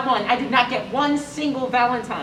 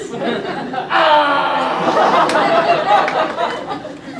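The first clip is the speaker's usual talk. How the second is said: embarrassed laugh; 6 semitones higher, 9 dB louder than usual. Acted. Nervous rehearsal breaks down.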